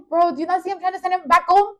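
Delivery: high, loud exasperated retort; incredulous and laughing. Retold or imitated.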